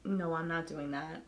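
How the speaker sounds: usual speaking voice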